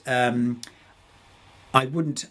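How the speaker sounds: ordinary talk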